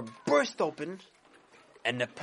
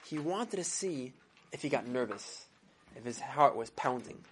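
ordinary delivery

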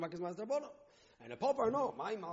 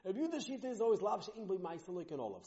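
ordinary delivery

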